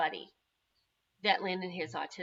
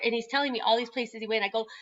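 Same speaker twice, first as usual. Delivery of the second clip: usual speaking voice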